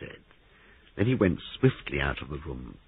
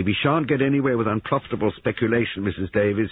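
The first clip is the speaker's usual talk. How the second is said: higher, severe rebuke; severe snub; 7 semitones higher, 4 dB louder than usual. A narrator's reading. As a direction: put her in her place. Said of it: "snubbed her severely".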